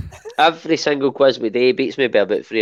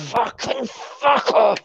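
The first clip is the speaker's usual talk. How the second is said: mock outrage; 9 semitones higher, 3 dB quieter, much slower than usual. Real, not acted. Mock outrage at being teased.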